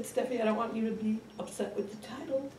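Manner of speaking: put-on high, soft old mother voice; elderly mother. Mother asking daughter for help with a book, high and frail. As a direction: ask sweetly in a small, high old lady's voice. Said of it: "She said".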